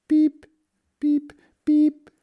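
tiny, very high-pitched peeps; duckling peeps. A narrator's reading. Unseen ducklings heard by the ditch, peeping. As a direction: squeak it like a baby duck.

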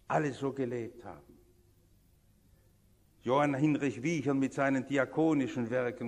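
usual speaking voice

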